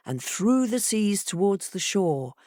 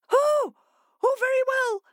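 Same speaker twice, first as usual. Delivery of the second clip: much higher, flustered fish; fish agreeing; 4 dB louder than usual. A narrator's reading. Fish giving in to the fox, high and fretful. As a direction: give in fretfully. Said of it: "agreed the fish".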